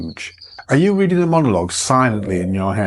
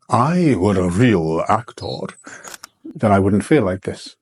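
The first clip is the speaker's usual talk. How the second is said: inner doubting voice; 3 semitones lower than usual. Retold or imitated. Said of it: "You think that if".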